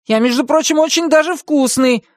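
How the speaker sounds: high-pitched offended rant; huffy little hero; indignant. A narrator's reading. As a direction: protest in a high, excited character voice. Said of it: "возмутился он".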